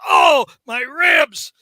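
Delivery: high, loud mock complaint; Spider-Man. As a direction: cry out in exaggerated pain, then keep wailing. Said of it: "And Spider-Man goes".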